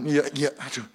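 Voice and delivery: ordinary delivery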